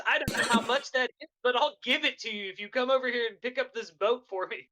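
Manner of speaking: high-pitched haggling appeal; pleading offer. Acted. Player bribing Mitch to lift the boat, high pleading offer. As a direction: pitch the voice up to win someone over.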